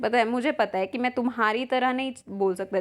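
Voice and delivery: usual speaking voice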